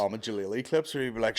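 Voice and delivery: normal talking voice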